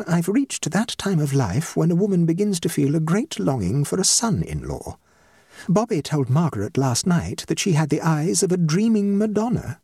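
lightly voiced dignified hostess; society hostess, stately. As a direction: play an impressive matchmaking mother.

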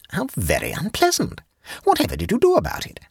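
very high-pitched, dismayed woman; high voice of an appalled listener; dismayed Jane. A narrator's reading. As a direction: react in a high voice, quickening into the question. Jane reacts to butler's delusions.

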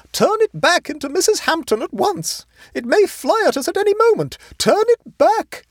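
very high, loud panicked demand; shrill screaming. A narrator's reading. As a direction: scream frantic orders at your nephew. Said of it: "she screamed shrilly".

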